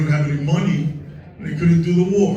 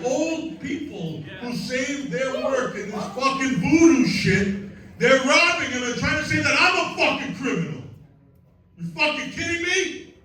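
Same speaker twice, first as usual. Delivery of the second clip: much higher, impassioned outrage; passionate rant; 9 semitones higher than usual. Real, not acted. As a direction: preach it like a fired-up protester. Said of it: "We got a lot of passion here".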